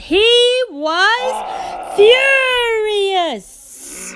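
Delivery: drawn-out high shout; furious. A narrator's reading. Narrator peaks on the farmer's rage, slow high shout. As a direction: howl the word furious. Climax of anger.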